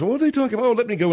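high-pitched puzzled listener, mock-wondering; curious viewer. Voices a curious viewer of the archives.

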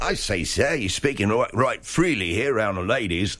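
lightly put-on old British shopkeeper accent; a hint of a prim English gent, scandalised. A goose seller to a vulgar American, mild British accent. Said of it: "'cause that accent".

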